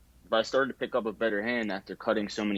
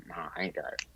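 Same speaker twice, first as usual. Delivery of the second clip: hushed admission; 3 semitones lower, 8 dB quieter, faster than usual. Retold or imitated. Admitting no license, retold.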